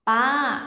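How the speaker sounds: "pa" loud and high, Vietnamese-style; wrong tone. Shown as a demonstration. Teacher shows what not to say. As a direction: say "pa" punched high, the mistake. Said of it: "đừng có".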